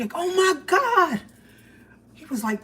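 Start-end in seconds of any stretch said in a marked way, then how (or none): 0.2-1.2 shocked. Retold or imitated. Shocked after knockout punch.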